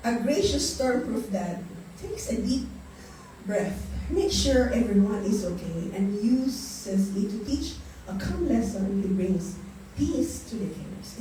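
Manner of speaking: slow, soft reading of a quote; reading a passage aloud. Acted. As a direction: read it gently and slowly, like a devotional. Reads a devotional passage. (mabagal at mahinahong pagbasa ng sipi)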